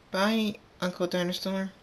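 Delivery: put-on high-pitched young filly voice; little filly goodbye. A narrator's reading. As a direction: call it high like a small foal.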